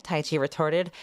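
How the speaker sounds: ordinary delivery, nothing marked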